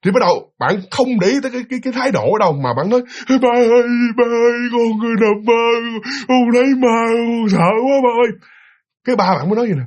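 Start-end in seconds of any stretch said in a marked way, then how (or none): 3.3-8.3 put-on frightened child. Child telling dad about a nightmare ghost, frightened. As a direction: wail it like a scared kid.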